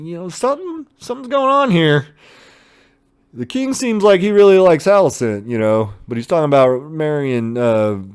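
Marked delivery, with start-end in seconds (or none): 0.0-2.0 high, half-mock suspicious musing; suspicious realization. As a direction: voice going up as it dawns on you. Realizing something is up.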